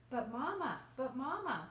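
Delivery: put-on loud, high call of a child; pleading child. A narrator's reading. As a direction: call out to mama like an eager kid. Child pleads with mother in story.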